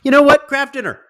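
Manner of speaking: high-pitched mock chat voice; imitating the chat. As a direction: burst in high and loud, then ease off. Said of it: "you guys were like".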